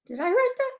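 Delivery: mock astonished wondering; amazed disbelief. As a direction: ask it as if stunned by your own work. Writer amazed at own work.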